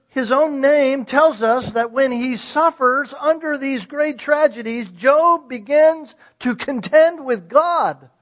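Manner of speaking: loud, impassioned sermon delivery; full-force preaching. Acted. A pastor preaching on Job's suffering, loud and impassioned. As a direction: preach it out with rising fervor.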